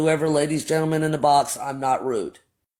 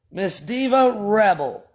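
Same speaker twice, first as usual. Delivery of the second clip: "Miss Diva Rebel" slow, each word weighed; slow formal; 4 semitones higher, slower than usual. Shown as a demonstration. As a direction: spell out "Miss Diva Rebel" slowly and pointedly. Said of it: "What is this young lady's name?".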